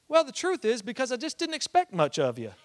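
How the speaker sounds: high, loud imitation of a grandma; blunt grandma. Grandma explaining her compliment to her grandson, blunt.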